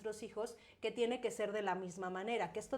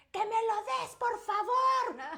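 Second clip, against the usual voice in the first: shrill-high yelled please, dragged out slowly; shouting por favor while demanding; yelling demo; 11 semitones higher, 6 dB louder, slower than usual. Shown as a demonstration. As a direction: shout the request at the child, much higher and louder. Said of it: "cómo te sientes cuando yo te estoy gritando".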